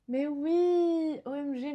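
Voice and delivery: light up on recognizing the name; excited recognition. Real, not acted. Recognizing the author, excited gasp. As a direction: gasp then high "Mais oui".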